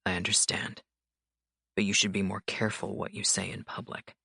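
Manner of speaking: cool authority, unhurried; stern mafia boss. A narrator's reading. Dante voiced by narrator.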